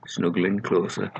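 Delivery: ordinary delivery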